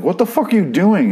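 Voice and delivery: high-pitched, exasperated disbelief; mock-appalled, pitch leaping up; incredulous outcry. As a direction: imitate someone appalled at a blunder. Voicing characters' reaction to her blunder.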